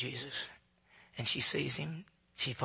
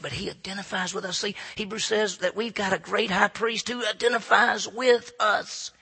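ordinary talk, nothing marked

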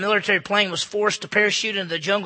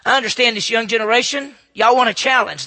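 louder preaching, driving the challenge home; preaching at full force; 4 semitones higher, 9 dB louder than usual. Acted. Preacher challenging the young generation, loud preaching. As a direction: push the challenge at the young crowd.

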